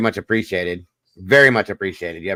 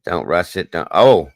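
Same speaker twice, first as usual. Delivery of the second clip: normal talking voice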